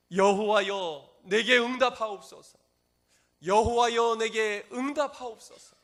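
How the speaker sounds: very loud, high, drawn-out prayer cry; desperate crying out. Acted. Elijah crying out to God in urgent prayer, full-voiced plea. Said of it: "하나님께 간절히 부르짖어 기도합니다".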